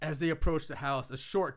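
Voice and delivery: ordinary talk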